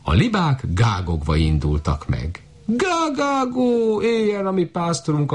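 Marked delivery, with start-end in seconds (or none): 2.6-5.3 cheering geese. A narrator's reading. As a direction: honk a joyful cheer.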